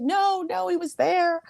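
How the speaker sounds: high, plaintive tearful protest; retold tearful whine; mock sobbing. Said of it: "I'm sobbing".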